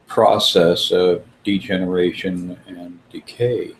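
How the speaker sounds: ordinary talk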